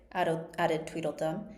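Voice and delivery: ordinary delivery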